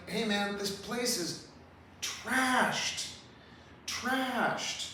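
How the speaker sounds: high, drawn-out disbelief; dismayed. Real, not acted. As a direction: stretch the word trashed in dismay. Lamenting ruined Palisades.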